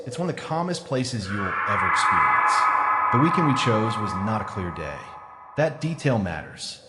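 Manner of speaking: usual speaking voice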